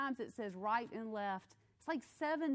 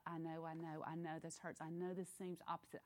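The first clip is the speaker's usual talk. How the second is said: gentle God; 4 semitones lower, 8 dB quieter, faster than usual. Pretend. God's gentle voice again.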